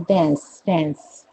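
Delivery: American "डांस" as "dans", front vowel; American pronunciation. Shown as a demonstration. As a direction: say "डांस" American, close to "dense". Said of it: "वो कहते हैं".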